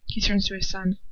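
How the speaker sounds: plain reading voice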